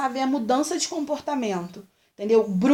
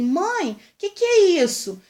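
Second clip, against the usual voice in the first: put-on child asking; 7 semitones higher, 5 dB louder than usual. Voicing a small child asking.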